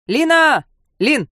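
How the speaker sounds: high yell down a corridor; shouting. A narrator's reading. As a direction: call out loudly into the flat. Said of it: "заорал в недра квартиры".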